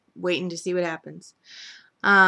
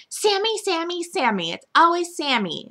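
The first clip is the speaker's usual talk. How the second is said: high whining complaint, much higher; Brady Bunch whine; 10 semitones higher, 3 dB louder than usual. Retold or imitated. Nicole complaining about Sammy, high jealous whine. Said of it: "pulls a Marsha Brady moment".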